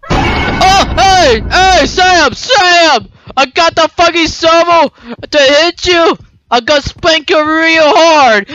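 high, loud gleeful threats; excited yell. Real, not acted. Player charging Sam with a shovel, yelling gleefully. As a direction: charge in shouting.

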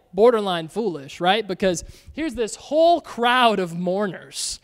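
ordinary talk

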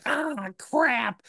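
high-pitched retold groan of realisation; drawn-out "oh no" moment, played for laughs; mock dismay. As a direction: act out realising your own blunder. Retold dismay at forgotten recording.

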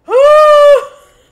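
a sudden loud cackle; loud burst of laughter. Real, not acted. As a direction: explode into a shocked laugh. Burst of laughter at NPC line.

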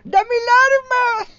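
very high, slow, shrill squeal of a cheap dub; mocking dub voice. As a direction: squeal like a stingy puppet. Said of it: "basura que era el doblaje".

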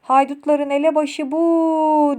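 slow, stretched shout, last word held long; shouting witch. Acted. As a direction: yell it after someone fleeing. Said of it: "cadılardan biri bağırdı peşimden".

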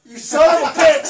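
furious shout at a friend; full-throated angry shout; angry yell. Real, not acted. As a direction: yell it out in betrayal. Angry outburst at betrayal in game.

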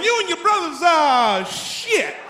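a father yelling out Saturday chores. Retold or imitated. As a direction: bark orders at the kids.

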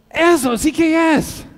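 loud, high, slow cry of relief; exultant customer, every word drawn out; excited exclamation. Acted. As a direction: burst out as if finally understood. Mexican customer finally finds socks.

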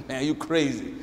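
low, dismissive skeptic voice; mocking skeptic. Skeptic scoffing at the believer, mock low voice. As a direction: scoff at him like a doubter.

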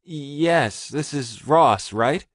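checking unsure in a small panic; nervous Richard. A narrator's reading. Richard nervously checking who he's talking to.

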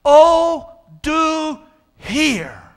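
OH, DO HEAR, each word drawn out high and loud; emphatic plea. Acted. Preacher voicing the psalmist's plea to God, emphatic. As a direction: cry out "Oh, do hear" with every word stressed. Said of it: "the word hear, uh, is emphatic".

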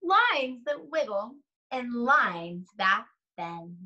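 put-on slow, sing-song read-aloud to young children; lingering on each rhyme, long pauses between pages; story read aloud. Reads picture book aloud slowly.

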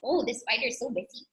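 ordinary delivery

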